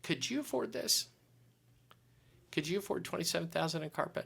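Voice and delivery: high, soft probing question; gently corner him with the question. Retold or imitated. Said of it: "She goes".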